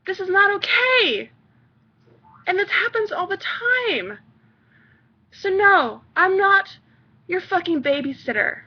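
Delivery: heated. Real, not acted. Heated rant.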